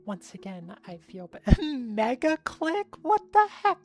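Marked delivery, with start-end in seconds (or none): 1.3-3.8 laughing outburst. Real, not acted. Teasing outburst at chat.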